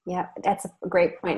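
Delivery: ordinary talk, nothing marked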